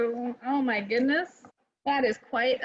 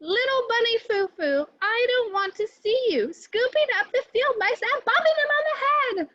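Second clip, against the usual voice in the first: much higher, louder furious scolding; angry fairy; 10 semitones higher, 3 dB louder than usual. Acted. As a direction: scold the bunny with real anger. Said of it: "she cried".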